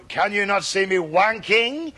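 put-on slow French-accented question; cod French accent. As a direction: drag out "wanking" in bad French accent. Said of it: "a man came on dressed as a Frenchman".

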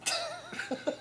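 shrieking giggle, high and breathy; high squeal of laughter. Real, not acted. Host cracks up at his own flubbed question, high squeal of laughter.

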